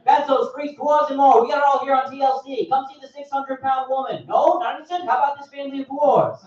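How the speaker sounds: high, loud carnival barker. Retold or imitated. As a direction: hawk the freaks to passers-by. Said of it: "should be the spokesperson".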